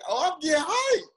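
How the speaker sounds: high, energized outburst; fired-up player feeding off energy; getting hyped. Acted. Player feeding off teammates' energy on the field, hyped. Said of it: "you give me energy".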